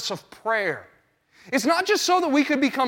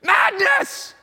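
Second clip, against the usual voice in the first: mock outcry; 8 semitones higher than usual. Comic outburst after mock panic.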